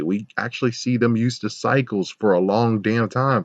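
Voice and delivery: ordinary talk, nothing marked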